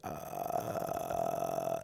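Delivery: extremely low creaky grunt, held. Shown as a demonstration. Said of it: "you would do that noise".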